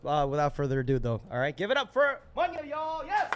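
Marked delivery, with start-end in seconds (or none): 1.6-3.3 high-pitched cheer for the next act; excited open-mic host intro; hype introduction. Real, not acted. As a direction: send the poet on stage with a high whoop. Host calls next poet on stage.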